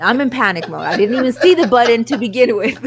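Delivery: loud, talking through laughter; amused outburst, cracking up on the words; real laughing. Real, not acted. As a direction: laugh at your own blunder as you tell it. Laughing at own panic.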